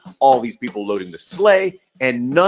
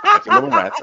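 rats squealed high; 3 dB louder, faster than usual. Acted. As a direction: shriek it high for effect. Repeated line high.

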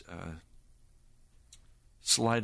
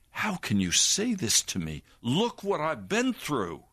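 angry protest; 7 semitones higher than usual. Retold or imitated. Preacher reliving his anger at God, high indignant protest. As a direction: throw the hurt back at God. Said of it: "anger just sprang up in my heart".

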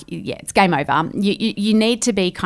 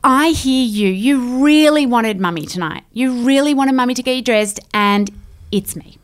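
kneeling empathy; 5 semitones higher, 3 dB louder than usual. Shown as a demonstration. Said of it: "you've gotta get down and say".

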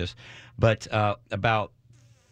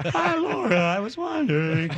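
put-on squeaky cracking teen voice; 13 semitones higher, 3 dB louder than usual. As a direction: let the voice crack up high like a boy. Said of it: "my voice would crack".

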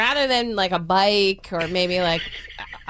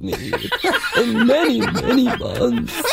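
Other Mother; 2 semitones higher than usual. Retold or imitated. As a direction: put on an eerie reproach, stretching the words. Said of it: "In that, in that voice".

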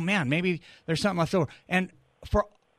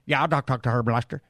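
Southern twang caller; 5 semitones lower, faster than usual. Retold or imitated. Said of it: "now got the twang".

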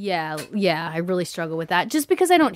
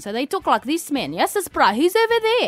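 put-on high, lively South African accent; exaggerated South African family voice; 8 semitones higher than usual. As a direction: imitate South African parents. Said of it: "my parents are South African".

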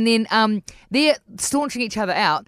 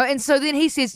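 ordinary talk

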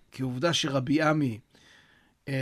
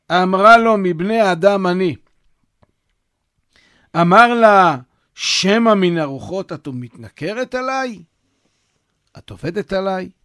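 reading the source text aloud; 4 semitones higher, 10 dB louder than usual. Acted. Reads the source story aloud.

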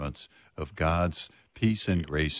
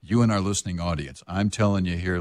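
ordinary talk, nothing marked

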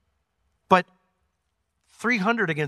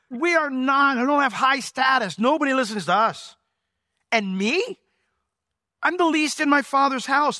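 higher, louder self-deprecating plea; pleading Gideon; 11 semitones higher, 5 dB louder than usual. Retold or imitated. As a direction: beg off, insisting you're nobody. Gideon pleads his lowliness.

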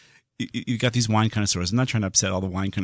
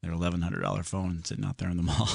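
ordinary talk

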